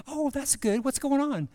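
high mom voice asking; mock mother's voice.

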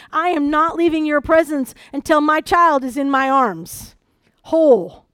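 loud, determined vow, landing hard on the last word; tenacious. Acted. Mother refusing to leave the prophet until her child is whole, tenacious. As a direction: stand your ground at full voice. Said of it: "This is the same tenacity".

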